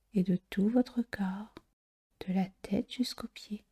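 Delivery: ordinary talk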